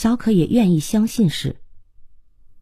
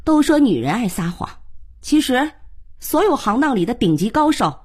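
higher-pitched resentful aside; bitter, gritted teeth; 6 semitones higher than usual. A narrator's reading. Aunt remembering a man who lied, bitter gritted-teeth remark. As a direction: spit it out through gritted teeth. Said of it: "忍不住咬牙切齿".

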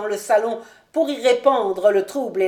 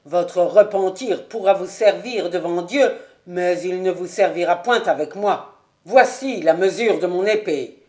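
louder, hard challenge; stern anger; 6 dB louder than usual. Acted. Colonel confronting Ravenswood, stern and loud. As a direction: throw his repentance back at him.

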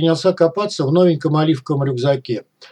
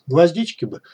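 ordinary delivery, nothing marked

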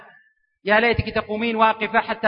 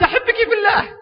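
much higher, blurted in a rush; a hammed-up shy groom forcing the words out; nervous blurt; 10 semitones higher, 3 dB louder than usual. Shy groom finally blurting love to his bride, high and rushed. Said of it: "يقول بسرعة قلت".

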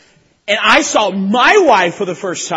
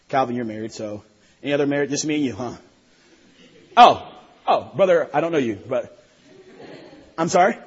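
ordinary talk, nothing marked

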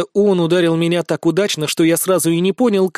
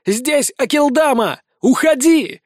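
loud, high frantic yell; furious shout; 7 semitones higher, 3 dB louder, slower than usual. Acted. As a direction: shout in fury for her to leave. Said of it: "кричу бешено".

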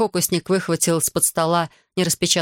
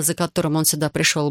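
ordinary delivery

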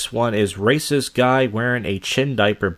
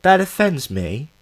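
mock offended bystanders; 9 semitones higher than usual. Mocking commuters who flee at the word, prissy and scandalised. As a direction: ham up the shocked commuters. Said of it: "everyone's like".

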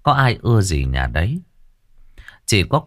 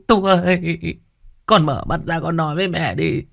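tearful wailing; 11 semitones higher than usual. A narrator's reading. Mother mourning over her dead son, tearful wailing. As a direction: sob and wail the name in grief. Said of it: "Mụ nước mắt giàn giụa".

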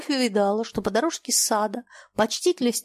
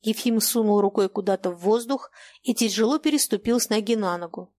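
usual speaking voice

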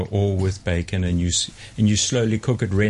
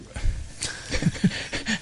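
breathy laugh; 12 semitones higher, 7 dB quieter than usual. Real, not acted. Laughing at his own accent.

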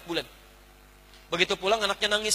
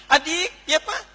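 calling out; 7 semitones higher, slower than usual. Retold or imitated. Father calls third child.

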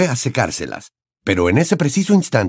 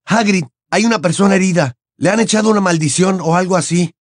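urgent; 8 semitones higher than usual. A narrator's reading. Harry urgent report.

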